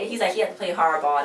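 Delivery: ordinary delivery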